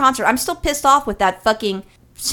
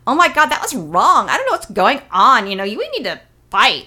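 mock Valley girl with swooping pitch. Podcaster mocking singer's outrage after Prop eight, Valley-girl impression. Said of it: "When did [name] turn into a Valley girl".

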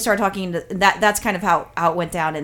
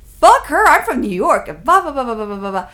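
indignant outburst running off into blah-blah; imitated exploding; 2 semitones higher, 5 dB louder than usual. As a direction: act out a drunk New Yorker blowing up. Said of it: "he's, like, exploding".

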